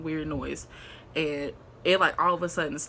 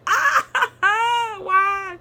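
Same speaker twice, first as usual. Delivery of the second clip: high laughing-crying wail; half-laughing, half-sobbing why; mock wail; 7 dB louder than usual. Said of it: "I was just like".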